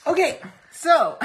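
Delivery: loud, high burst of laughter; shouting laugh. Real, not acted.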